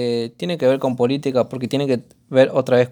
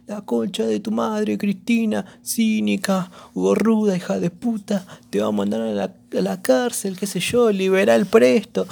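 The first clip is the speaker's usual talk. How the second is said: stiff timid heckler; 8 semitones higher than usual. Retold or imitated. Stiff protester heckling Cristina, timid mock insults. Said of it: "el chabón estaba ahí todo re duro".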